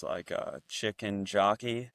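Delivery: ordinary talk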